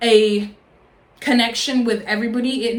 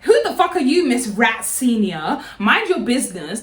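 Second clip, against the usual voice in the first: ordinary delivery, nothing marked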